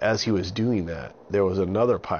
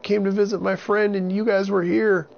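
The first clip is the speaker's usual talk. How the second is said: put-on very high, loud frightened boy; scared boy voice; 13 semitones higher, 5 dB louder than usual. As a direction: plead like a panicked little boy. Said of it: "And Avon said".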